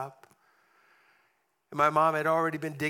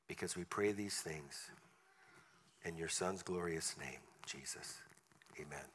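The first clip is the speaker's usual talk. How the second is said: prayer; 5 semitones lower, 5 dB quieter than usual. Acted. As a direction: pray quietly over the congregation. Closing prayer.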